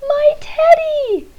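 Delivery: very high delighted cry; a boy crying out at finding his teddy; joyful cry. Acted. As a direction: squeal with relief. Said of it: "cried Eddie".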